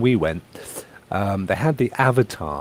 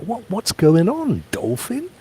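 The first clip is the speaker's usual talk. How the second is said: higher, startled "what's going on"; mock shock; 10 semitones higher than usual. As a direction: act out disbelief at the menu. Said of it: "so I'm like".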